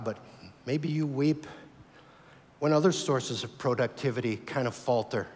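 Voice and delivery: normal talking voice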